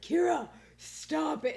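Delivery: drawn-out pleading "stop it"; imitating annoyed boyfriend. Kyle scolding Kira for fidgeting, mock annoyed.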